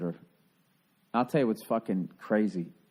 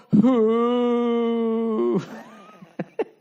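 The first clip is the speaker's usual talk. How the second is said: high whooping burst of laughter, much louder; 12 semitones higher, 9 dB louder than usual. Real, not acted. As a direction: let a shrieking laugh rip. Cracks up at his own impression.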